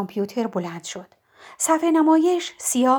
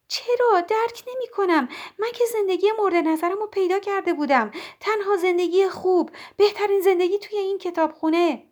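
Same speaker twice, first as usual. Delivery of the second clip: high, pleading bewildered voice; distressed Nora; 7 semitones higher than usual. Acted. Nora protesting to Mrs Elm that she found her life, high and upset. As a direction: Nora not understanding, near panic.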